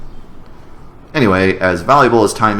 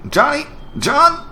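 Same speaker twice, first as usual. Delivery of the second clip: much higher, calling across a distance; slower than usual. Acted. Searching for Johnny, calling his name. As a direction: call for Johnny to come back.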